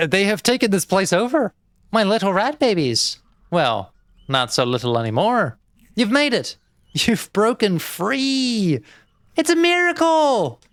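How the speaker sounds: high, loud, overjoyed gushing; loud overjoyed praise; gushing delight. Acted. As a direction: coo proudly over your grown babies. Gushing over rat babies.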